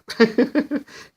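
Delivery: a real high burst of laughter. Real, not acted. Reviewer cracking up at the Devil Dinosaur twist, high laugh. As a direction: laugh out loud at the joke.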